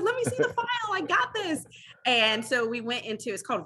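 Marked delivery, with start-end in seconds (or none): 0.0-1.6 high, bubbly I-got-this; eager confidence. Retold or imitated. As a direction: grab the file like you were born for it. Eagerly taking on the case.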